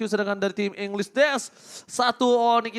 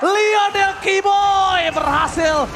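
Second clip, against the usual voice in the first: loud, high hype play-by-play; fighting game announcer; 12 semitones higher, 6 dB louder than usual. Acted. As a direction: call the teamfight at full hype. Hype teamfight call.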